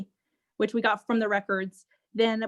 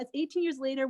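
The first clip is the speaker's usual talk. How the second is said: ordinary talk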